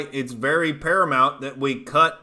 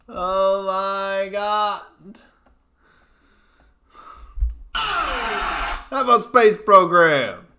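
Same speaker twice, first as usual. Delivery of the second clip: higher, slow, dragged-out disbelief; stunned disbelief; 6 semitones higher, 4 dB louder, much slower than usual. Acted. Streamer gasping at a chat claim, slow and incredulous. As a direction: stretch it out like you can't believe it.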